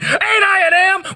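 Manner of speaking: a sudden high outcry breaking the chant; startled high cry of protest. Acted. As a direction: leap way up high on the question. Outburst inside the poem.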